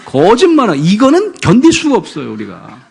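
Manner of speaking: high, loud exasperated outburst; mock outraged parent. Parent venting about a lying child, loud and exasperated. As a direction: play the fed-up parent who can't stand it.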